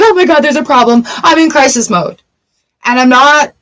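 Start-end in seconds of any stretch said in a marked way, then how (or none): 0.0-2.2 hammed-up crisis freakout; mock panic. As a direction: rattle it off like you're in crisis mode. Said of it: "we're like".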